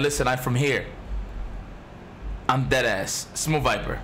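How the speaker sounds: usual speaking voice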